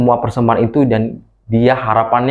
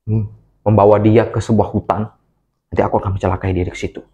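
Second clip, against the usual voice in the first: scheming Cain; 3 semitones lower than usual. A narrator's reading. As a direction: mutter the plan darkly and low. Cain plots against brother. (Kain merencanakan kejahatan dengan suara rendah dan pelan)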